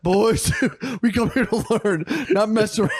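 hammed-up indignation, cracking up; mock stern teacher. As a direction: imitate a cross teacher telling the class off. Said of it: "He got all mad and serious".

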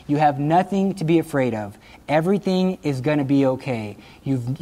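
usual speaking voice

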